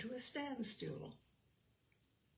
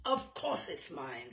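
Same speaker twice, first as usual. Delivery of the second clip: lower, biting claim; vicious; 6 semitones lower than usual. Acted. Soapy claiming the umbrella to its owner, lower and vicious. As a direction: snap it out, lower and mean. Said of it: "said Soapy viciously".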